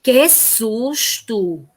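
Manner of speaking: a slow, drawn-out que susto! in a startled tone; mock fright. Shown as a demonstration. Teacher demos an exclamation to pupils, mock startled and slow. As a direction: act out a small scare for the kids. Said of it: "Então, exemplo".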